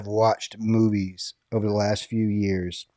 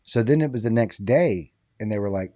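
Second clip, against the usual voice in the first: usual speaking voice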